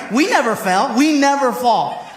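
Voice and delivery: normal talking voice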